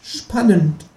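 a high, cheeky kangaroo asking. Acted. Kangaroo asks the narrator abseiling from the balcony, high and cheeky. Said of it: "sagt das Känguru".

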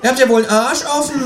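high, swearing kangaroo; cursing. A narrator's reading. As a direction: swear at them in a huff. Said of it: "beginnt das Känguru zu fluchen".

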